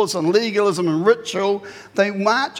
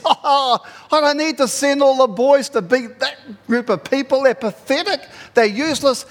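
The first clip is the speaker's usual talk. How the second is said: louder, higher, dismissive boast; cocky confidence; 5 semitones higher, 3 dB louder than usual. Acted. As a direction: scoff with overconfident swagger. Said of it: "he's confident in himself".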